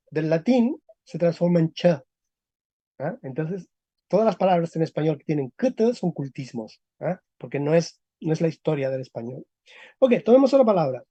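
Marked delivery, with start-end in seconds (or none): none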